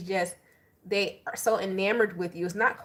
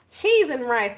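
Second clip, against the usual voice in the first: exasperated exclaim; 4 semitones higher, 6 dB louder, slower than usual. Real, not acted. Exasperated reaction to card.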